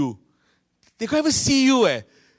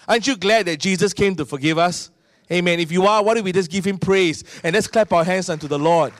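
ordinary talk

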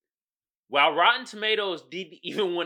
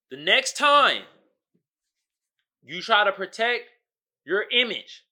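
emphatic call; 5 semitones higher, 6 dB louder than usual. Real, not acted. Preaching emphatic call to action.

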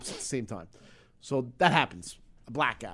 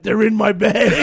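loud, higher blurt; retold yell; 6 semitones higher, 12 dB louder, slower than usual. The wife finds the pills, loud high shout. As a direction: shout it out triumphantly. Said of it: "right at the top of my head".

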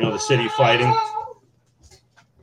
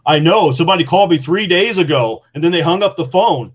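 higher, louder voice of an upset father; upset dad; 8 semitones higher, 10 dB louder than usual. Retold or imitated. Dad telling his son about the mystery call, loud and upset. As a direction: blurt it like a dad worried sick for days.

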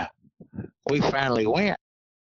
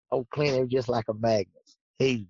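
ordinary talk, nothing marked

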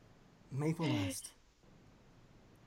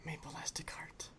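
whisper; 16 dB quieter than usual. Shown as a demonstration. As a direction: whisper the phrase to yourself. Guessing phrase, repeats it softly.

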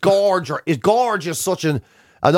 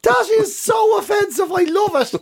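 gleeful outrage. Real, not acted. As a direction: squeal it high like you're scandalised but thrilled. Gleeful reaction to offensive nickname.

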